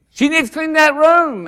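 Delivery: high-pitched mock scolding; hard-on-them mother. Miss Merle orders the boys to clean, high mock sternness. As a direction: pitch it up like a mother laying down rules. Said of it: "Miss Merle could be so hard on 'em".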